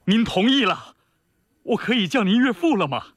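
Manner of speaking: high, crisp soldierly eagerness; elated, formal request to a future father-in-law; eager salute. A narrator's reading. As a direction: ask like a suitor standing at attention. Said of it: "挺胸敬礼".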